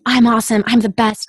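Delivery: loud pumped-up affirmations; self-hype. Retold or imitated. Actor hyping herself on the subway before an audition, loud and pumped. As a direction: pump yourself up before going in. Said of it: "I was like".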